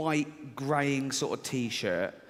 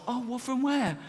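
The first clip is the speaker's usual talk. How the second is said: higher, puzzled question; mock surprise; 8 semitones higher than usual. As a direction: play the baffled listener. Acted dialogue, a puzzled listener.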